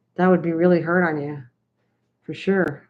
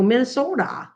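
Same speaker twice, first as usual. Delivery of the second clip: put-on higher, swooping Minnesotan accent on Minnesota; playful imitation of a Minnesota accent; 6 semitones higher than usual. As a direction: mimic a local saying Minnesota, pitch leaping up. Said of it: "I like accents".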